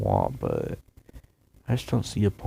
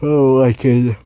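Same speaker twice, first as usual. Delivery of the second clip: yawning while talking; 5 semitones higher, 6 dB louder than usual. Real, not acted. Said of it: "Sorry for my yawning".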